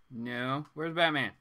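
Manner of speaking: ordinary talk, nothing marked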